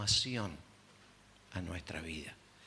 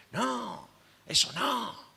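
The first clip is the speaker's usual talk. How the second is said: indignant denial; 9 semitones higher, much slower than usual. Retold or imitated. Marido niega acusación.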